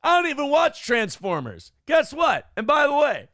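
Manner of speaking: high, loud mock outrage at a pun; exasperated whining. Real, not acted. Groaning at a friend's Autobot pun in high outrage. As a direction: whine it up high like you can't believe him.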